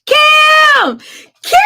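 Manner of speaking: loud, high excited call. Real, not acted. Singer spotting friend Kim online, calling her name loudly. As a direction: yell her name across the room.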